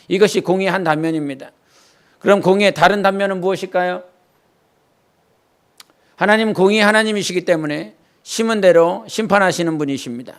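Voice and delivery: ordinary talk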